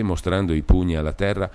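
plain reading voice

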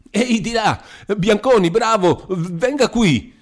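high, loud shout to the next room; shrill call across the office. A narrator's reading. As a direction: yell for help, slowing on the last words. Calling for help.

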